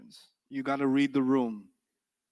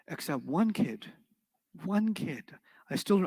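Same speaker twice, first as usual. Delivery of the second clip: ordinary talk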